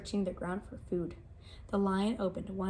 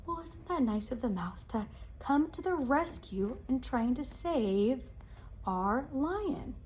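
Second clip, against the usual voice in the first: ordinary delivery, nothing marked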